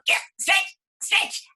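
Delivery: high, loud urging; squeaky flower cheering it on; straining shout. Acted. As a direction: call out stretch, stretch. Flower urges stretching.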